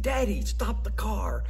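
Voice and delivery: put-on high pleading kid voice from the back seat; child's voice. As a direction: beg like a little kid who spotted a kitten. Kids begging father in car.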